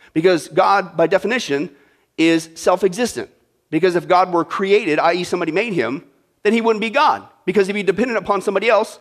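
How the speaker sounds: usual speaking voice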